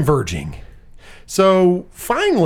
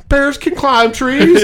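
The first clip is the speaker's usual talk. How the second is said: exasperated correction; 7 semitones higher, 4 dB louder, slower than usual. Retold or imitated. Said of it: "the inspector goes".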